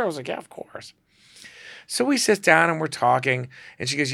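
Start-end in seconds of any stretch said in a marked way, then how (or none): 0.3-0.9 whispered. Retold or imitated. Eager reply whispered.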